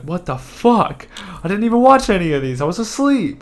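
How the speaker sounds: high, loud and incredulous; shocked outburst. Retold or imitated. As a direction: play the coworker waking up baffled. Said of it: "She was like".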